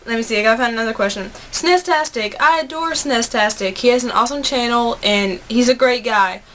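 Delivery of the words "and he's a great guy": normal talking voice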